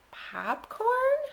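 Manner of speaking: high rising baffled question; puzzled. A narrator's reading. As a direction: ask it high, not understanding. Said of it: "Pete and the gang were puzzled".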